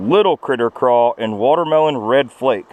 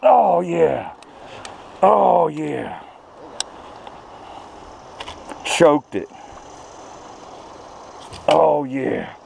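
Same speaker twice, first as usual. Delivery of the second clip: elated; 5 semitones higher, 4 dB quieter, much slower than usual. Real, not acted. Landing a smallmouth, elated.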